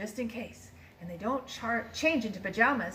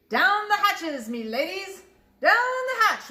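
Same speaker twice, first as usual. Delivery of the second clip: loud, much higher table toast; pirate yell; 9 semitones higher, 6 dB louder, slower than usual. A narrator's reading. Captain toasting his crew at dinner, loud yell. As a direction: bellow it, pounding the table. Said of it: "pounded his fist on the table and yelled".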